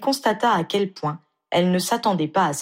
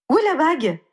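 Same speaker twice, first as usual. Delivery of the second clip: sudden alarm; 11 semitones higher, 4 dB louder, faster than usual. Acted. Ginny suddenly missing the ring.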